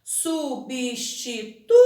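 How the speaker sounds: "Substituto" slowly in syllables, leaning on TU; stress on TU. Shown as a demonstration. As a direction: draw out "Substituto", highlighting TU. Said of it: "destaquem a sílaba pronunciada com mais intensidade".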